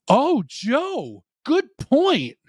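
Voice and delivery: very high-pitched, drawn-out reaction; high sing-out of approval; exaggerated agreement. Real, not acted. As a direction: jump way up in pitch for the viewer. Reacting to Joe's live comment.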